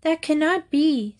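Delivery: high, small lamb voice; meek lamb. A narrator's reading. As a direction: protest gently. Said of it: "said the lamb".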